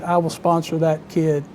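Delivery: ordinary talk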